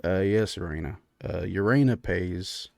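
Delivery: low, soft, meek husband; browbeaten furbolg host. Acted. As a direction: give in quietly, pitched low. Game master plays Clyde.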